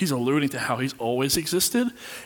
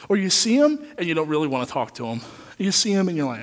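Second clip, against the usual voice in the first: ordinary delivery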